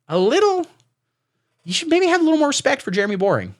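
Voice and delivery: high, fast, prissy reproach; mock scolding. Comedian chides Tucker for disrespecting Jeremy, mock scolding. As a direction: wag a finger at Tucker. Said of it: "comedian scolding Tucker".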